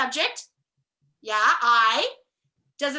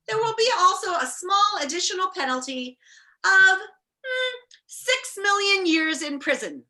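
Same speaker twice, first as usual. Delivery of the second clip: judge; 8 semitones higher, 5 dB louder than usual. Acted. Judge announces sentence.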